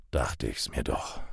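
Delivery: low, soft growl. Acted. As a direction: growl it under your breath. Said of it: "knurrte er".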